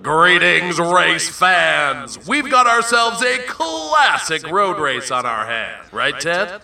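sports announcer. Acted. Sports announcer opens the race.

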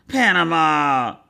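slow, drawn-out name; long-stretched chiding call; mock chiding. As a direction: draw the name out in mock reproach.

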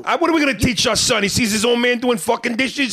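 loud, indignant New York Italian husband shouting. Pretend. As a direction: rant like a macho Italian guy asked to do dishes. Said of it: "do you do an Italian lady".

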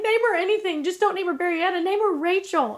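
high-pitched pleading, imitating an exasperated sister; retold freak-out. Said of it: "was freaking out".

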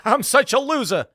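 high, loud self-mocking whine; Rodney Dangerfield. Standup mocking himself to the crowd, whiny loser voice. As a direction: play the loser for laughs. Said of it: "being like".

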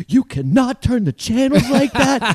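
put-on high, slow scolding old-timer; hammed-up old-school grandparent telling off a kid; stern grandparent. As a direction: scold slowly like a strict grandparent. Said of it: "And they're like".

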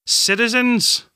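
high, slow ringing call to a crowd; bold voice. Acted. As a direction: call out so it carries across a hall. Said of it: "in a bold voice that carried across the hall".

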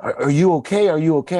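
high-pitched repeated worried questions, retold; terrified mom. Mom seeing her son cry in front of her, terrified. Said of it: "my mom gets terrified".

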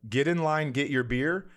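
ordinary delivery

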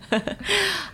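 a quick amused laugh; short real laugh. Real, not acted. Guest laughing at people's remark about her accent. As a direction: laugh lightly at the irony.

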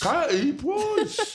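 very high, drawn-out mock British squeal; hammed-up British accent. Comic escalating the paparazzo impression for laughs, hammed-up British accent. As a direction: stretch it into an absurd British caricature.

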